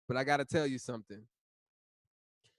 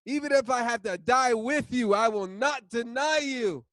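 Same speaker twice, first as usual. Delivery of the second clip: fervent vow; 7 semitones higher, 5 dB louder than usual. Acted. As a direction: swear it with everything. Peter repeats vow.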